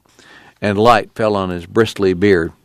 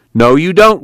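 sharp raised no, louder; loud firm refusal, voice up high; calls out to stop him; cried out; 8 semitones higher, 5 dB louder than usual. A narrator's reading. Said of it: "Willie cried".

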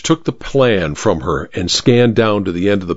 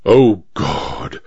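sudden dread; 7 semitones lower than usual. Acted. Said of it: "said the judge suddenly".

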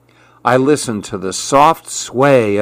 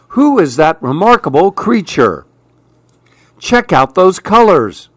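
excited; 6 semitones higher than usual. Acted. Excited onlookers.

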